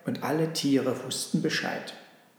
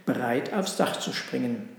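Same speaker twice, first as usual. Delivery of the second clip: usual speaking voice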